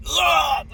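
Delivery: retching sound. Retold or imitated. Telling how he started vomiting, a mock retch. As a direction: imitate throwing up.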